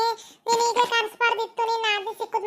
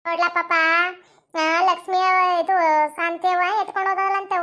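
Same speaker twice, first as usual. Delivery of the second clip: normal talking voice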